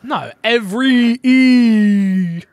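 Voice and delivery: "every E" drawn very slowly, high and loud on the E; stretched final E. Shown as a demonstration. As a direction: hold the E in "every" long and loud. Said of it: "You're putting an emphasis on the, on the Y".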